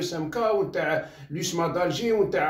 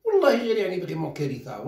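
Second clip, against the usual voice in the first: normal talking voice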